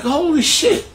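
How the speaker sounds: amazed. Retold or imitated. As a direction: gasp it out in disbelief, up high. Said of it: "So I was like".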